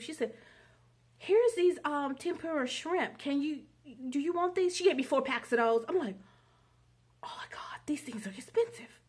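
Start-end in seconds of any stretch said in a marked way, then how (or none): 1.2-4.8 high, bright-eyed offer of free shrimp; chirpy offering voice. Retold or imitated. Stranger at the store offering free shrimp, high and eager. 7.2-9.0 hushed awed whisper; whispered amazement. Retold or imitated. Stunned shopper whispering over expensive free shrimp.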